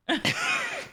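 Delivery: high-pitched fit of laughter; real high laughing fit; helpless laugh. Real, not acted. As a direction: crack up at your own story. Cracks up at own story.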